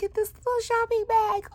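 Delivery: high, delighted coo. Real, not acted. Crafter showing a tiny shopping bag, high coo. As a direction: show it off like a treasure.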